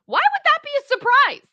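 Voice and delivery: high, loud incredulous outburst; can't believe it, shouting at her; outraged disbelief. Real, not acted. Streamer reacts in outrage.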